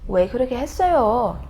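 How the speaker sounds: "왜 그렇게 했어요?" quick, high, with a swooping ending; emotive Korean intonation. Shown as a demonstration. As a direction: ask "왜 그렇게 했어요?" with feeling. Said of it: "the feeling that you want to convey".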